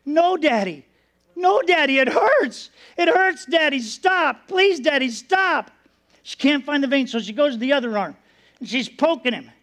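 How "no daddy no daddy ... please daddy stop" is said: put-on high, pleading cries of a hurting child; retold scream, pitched up in short bursts; imitated child screaming. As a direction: plead like a small child in pain, without a full scream. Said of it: "he starts screaming".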